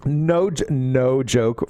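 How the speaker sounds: usual speaking voice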